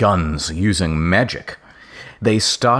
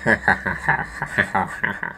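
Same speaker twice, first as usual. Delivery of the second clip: staged villain cackle; pulsing mock laugh, no real mirth; angry laugh; 4 dB quieter than usual. Snape reacts to her refusal, angry mock laugh. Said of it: "Snape laughed angrily".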